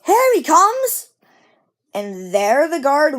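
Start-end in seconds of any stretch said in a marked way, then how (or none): 0.0-1.1 high, loud, slow shout; little boy shouting; child shouting. Acted. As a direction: call out excitedly like a little boy. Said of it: "a little boy shouted".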